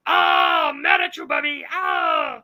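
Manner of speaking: high, loud dinosaur roar; hammed-up monster tantrum; mock yelling Texas girl. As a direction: roar it open-mouthed like a cartoon dinosaur. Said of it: "that's a hundred percent her".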